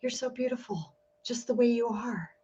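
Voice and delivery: soft, hushed and tender; tender compliment. Retold or imitated. Imagined partner softly tells her she's beautiful, tender. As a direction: murmur it like a loving partner. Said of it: "and been like".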